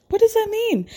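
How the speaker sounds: high, baffled repeat of the question; incredulous. Real, not acted. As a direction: ask it again in disbelief. Incredulous at Brandy's eyebrows answer.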